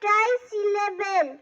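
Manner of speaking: loud recitation. Acted. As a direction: declaim it to the whole class. Child reciting trisyllable role.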